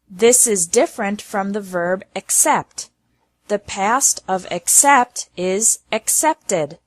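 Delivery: ordinary talk, nothing marked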